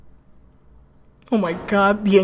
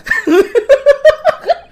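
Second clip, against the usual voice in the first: high squealing laugh; 7 dB louder than usual. Real, not acted. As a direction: burst into a high-pitched giggle. Laughs at becoming a lawyer.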